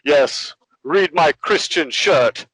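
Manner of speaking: slow, booming mock Superman proclamation; hammed-up heroic preacher voice; superhero pose voice. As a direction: puff up like a proud superhero. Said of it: "like he's Superman".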